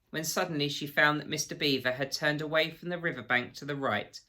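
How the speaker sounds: plain reading voice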